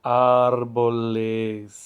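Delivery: árboles said slowly and loudly, syllable by syllable; slow model word. Shown as a demonstration. Teacher models the plural árboles for the class, slow and clear.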